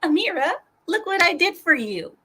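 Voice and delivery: high, mocking "look what I did"; mock proud Suka. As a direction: show off the gift, cloyingly pleased. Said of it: "I'm sure she's gonna love it".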